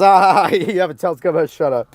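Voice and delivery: laughing mock dismissal; mocking Nathan. Ranter imitates a flat earther laughing off the telescope, mocking. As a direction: sneer through a laugh, then brush it off. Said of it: "Nathan goes".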